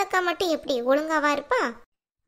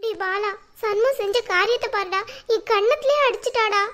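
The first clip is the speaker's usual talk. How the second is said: higher-pitched, put-on weepy grievance; tearful complaint; 4 semitones higher than usual. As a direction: wail your accusation to your son. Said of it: "அம்மா, அழாதீங்கம்மா".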